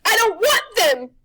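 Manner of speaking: slow, high, loud crone yell; witch yelling. Acted. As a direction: yell it, struggling. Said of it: "she yelled".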